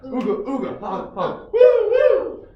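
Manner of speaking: goofy honks ending in high loud woos; silly clown. Shown as a demonstration. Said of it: "fun song motions and sounds".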